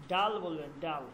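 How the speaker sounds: ডাল with a hard retroflex d; teacher modelling ডাল with retroflex d; demonstrated retroflex ডাল. Shown as a demonstration. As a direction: curl the tongue back to start ডাল. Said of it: "তখন কি বলবেন?".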